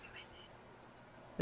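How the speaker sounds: slow unvoiced whisper. Real, not acted. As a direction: whisper the question. Whispered question to party.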